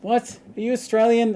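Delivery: high, loud acted disbelief; astonished. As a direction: exclaim in amazed recognition. Acted astonishment at meeting fellow Australians.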